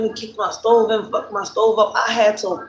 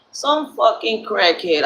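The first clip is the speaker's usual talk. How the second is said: ordinary talk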